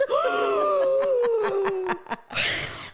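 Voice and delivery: loud, high gasp of outrage; high scandalized gasp; shocked gasp. Retold or imitated. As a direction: gasp like you've been deeply insulted. Guy shocked at being called asshole.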